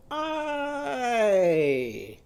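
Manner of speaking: long high cry sliding down; Ike's long sound. Shown as a demonstration. Teacher pedals up into the sky, long high cry. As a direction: hold a high "eye" and let it fall. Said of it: "make Ike's sound and go".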